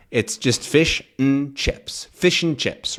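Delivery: slow "fish and chips", "and" reduced to n; syllabic n. Shown as a demonstration. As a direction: stretch "fish and chips" showing the n. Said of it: "You can't even say fishin'".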